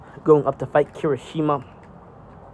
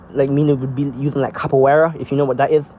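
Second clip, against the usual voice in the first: ordinary talk, nothing marked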